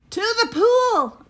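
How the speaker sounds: high, loud yell; boy yelling. A narrator's reading. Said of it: "I yelled".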